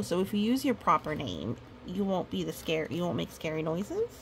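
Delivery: ordinary delivery, nothing marked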